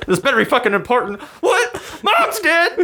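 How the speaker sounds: very high, fast, laugh-torn mock voice; laughing retelling. Retelling the phone bit, cracking up, high and loud. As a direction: squeal it through laughter. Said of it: "That made me fucking start laughing".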